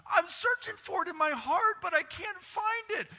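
high, loud, desperate crying plea; loud pleading cry. Retold or imitated. Pastor retells pleading with God, in a loud high cry. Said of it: "I was crying. I was weeping".

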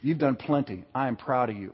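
a quiet, intimate word of approval, not fully whispered; hushed reassurance. Acted. God speaking to a weary believer, hushed reassurance. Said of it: "When God whispers to you and says".

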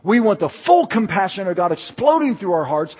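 high, loud, building pulpit fervor; impassioned preaching. Real, not acted. Preacher rousing his church for God's power, building impassioned preaching. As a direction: drive it up to a ringing rallying cry.

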